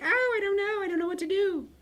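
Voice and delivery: mock high-pitched panic; panicking students. Teacher mimicking students who freak out, high mock panic. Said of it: "And they're like".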